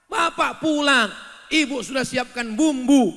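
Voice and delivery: high, loud storytelling. Real, not acted. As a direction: call it out to the hall. Preacher telling scene loudly. (bercerita dengan suara lantang)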